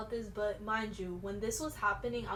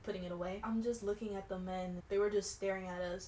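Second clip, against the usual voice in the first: ordinary talk, nothing marked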